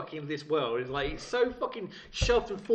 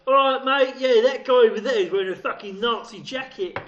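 rough london slang; 6 semitones higher, 10 dB louder than usual. Retold or imitated. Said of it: "you're not gonna get many chavs go".

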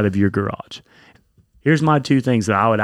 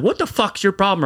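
higher-pitched retold angry challenge; pissed off; 8 semitones higher than usual. Man confronting the driver who nearly hit them, pissed off. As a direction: replay an indignant demand, pitched up. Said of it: "I was so pissed off".

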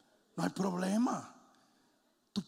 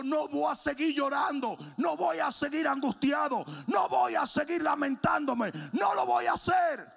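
high shouted refusals, building; defiant resolve; 11 semitones higher, 7 dB louder than usual. Retold or imitated. David refuses to keep crying, high defiant shout. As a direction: shout each refusal harder than the last.

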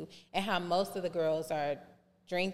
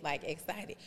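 ordinary talk

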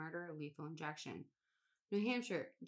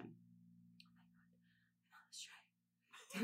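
whisper; 12 dB quieter, much slower than usual. Real, not acted. Recognizes the next case.

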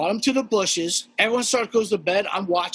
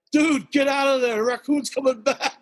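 shouted warning; 7 semitones higher than usual. Retold or imitated. Neighbors warning the man, shouting from balconies. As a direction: call out to him. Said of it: "We're like".

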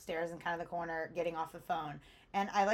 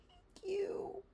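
tearful thanks; 11 semitones higher, 8 dB quieter than usual. Retold or imitated. Thanking through tears.

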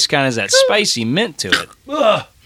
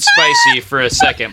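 laughing. Real, not acted. Disgusting bean, group cracking up.

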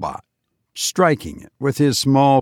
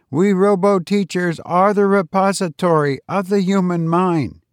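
higher, measured robot teacher; robot teacher explaining witches to the class, higher and measured; 7 semitones higher than usual. A narrator's reading. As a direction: lecture like a patient machine.

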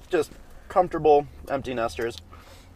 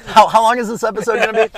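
mock alarm; 12 semitones higher, 9 dB louder, faster than usual. Real, not acted. Mock dread at Malort question.

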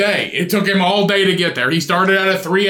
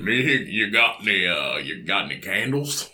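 much lower, quieter gruff ask; 10 semitones lower, 7 dB quieter than usual. Retold or imitated. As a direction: mumble it like a gruff farmer.

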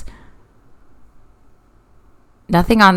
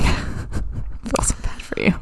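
soft sympathetic whisper; 11 dB quieter, slower than usual. Real, not acted. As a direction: breathe it out gently. Sympathy for friend's sore voice.

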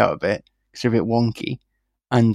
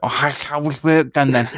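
imitating lisp; 3 semitones higher than usual.

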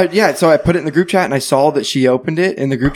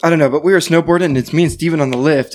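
normal talking voice

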